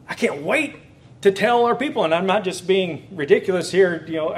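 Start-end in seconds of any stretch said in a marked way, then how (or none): 0.1-2.0 higher, eager rush to share; can hardly wait to tell them; eager excitement. Retold or imitated. Retelling his excitement over Romans.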